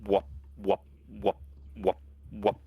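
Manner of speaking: evenly spaced little wop noises; imitated cuttlefish noise. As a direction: imitate a creature going wop, with pauses. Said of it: "They're like".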